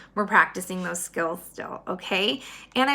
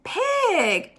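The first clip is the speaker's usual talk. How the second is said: mock shout; 10 semitones higher than usual. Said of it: "Eugenia shouted".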